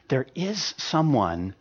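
ordinary talk

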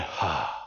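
low, soft exhaled sigh; sigh of relief. Retold or imitated. Man realising he is safe, sighing in relief. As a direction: let all the tension out in a breath.

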